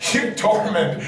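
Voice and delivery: normal talking voice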